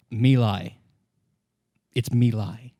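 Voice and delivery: ordinary delivery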